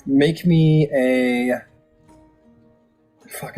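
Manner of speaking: usual speaking voice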